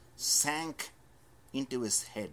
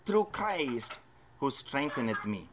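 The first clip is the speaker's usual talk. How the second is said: scripture quote; 5 semitones higher than usual. Acted. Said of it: "He says".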